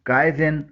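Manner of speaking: काइजिन said as "KAI-zin"; wrong-way काइजिन, "kai-zin"; common mispronunciation. Shown as a demonstration. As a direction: say काइजिन with no w after k. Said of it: "कोई क्वाइजाइन बोलता है".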